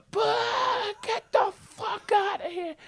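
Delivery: high, slow screechy heckle; mock old-people yelling. Old crowd yelling at comic in Santa suit, mock screech. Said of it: "They're yelling at me, these old people".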